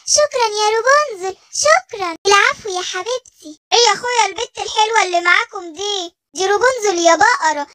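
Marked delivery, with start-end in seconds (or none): 0.1-2.2 delighted surprise. Acted. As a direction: burst out amazed the pain is gone. Giraffe's pain vanishes.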